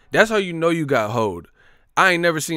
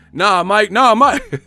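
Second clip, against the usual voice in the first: higher, louder begging "Nah, Mike"; loud pleading; 8 semitones higher, 8 dB louder, slower than usual. Retold or imitated. Voices the scared interviewer.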